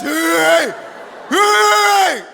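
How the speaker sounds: very high, loud blaring alarm; vocal siren-like alarm imitation; imitated alarm ringtone. Shown as a demonstration. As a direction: wail like a phone alarm going off. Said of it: "Moi, j'ai un hué".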